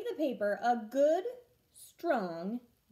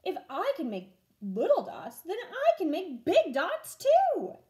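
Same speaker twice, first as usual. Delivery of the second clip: excited; 7 semitones higher, 7 dB louder than usual. Acted. Vashti excited experimenting with dots.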